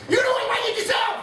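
high, shrieking outburst; screamed insult; sudden shout. Retold or imitated. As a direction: explode at him out of nowhere. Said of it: "you say".

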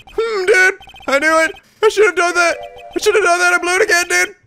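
high-pitched dude kicking himself; Larry, regretful. Acted. Hapless dude berates himself for blowing it, high and regretful. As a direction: hapless slacker in a high, self-pitying voice.

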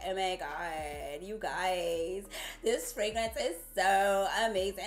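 put-on Valley girl voice. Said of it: "such Valley girl".

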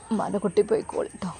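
soft, quick, tearful goodbye; tearful farewell. A narrator's reading. Mother kissing her daughter goodbye through tears, soft and quick. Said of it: "ചുംബിച്ചുകൊണ്ട് കരഞ്ഞു".

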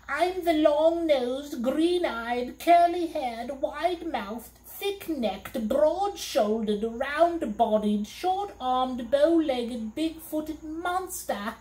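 high, slow monster list, each word savored; high put-on monster voice reeling off his traits; bragging monster. A narrator's reading. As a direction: brag each trait like a fiend. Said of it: "he replied".

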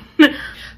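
breathy laugh. Real, not acted. Laughs at own retainer voice.

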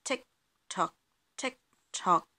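short, evenly spaced ticks and tocks; ticking clock. A narrator's reading. Living room clock ticking at night, imitated. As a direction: tick like a clock, with gaps between.

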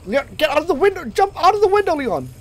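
high frantic shout; being chased. Real, not acted. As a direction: yell in full panic while fleeing. Frantic instruction while chased.

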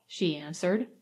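plain reading voice

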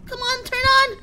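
real terrified bravado; panicked threats. Real, not acted.

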